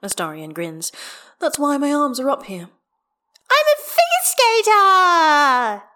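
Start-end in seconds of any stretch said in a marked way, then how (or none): 1.4-2.7 higher, lightly amused; grinning character voice. A narrator's reading. Astarion grinning, teasing the child. 3.5-5.8 put-on high, loud child's whoop, drawn out; happy yell. A narrator's reading. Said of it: "Hestia yells happily".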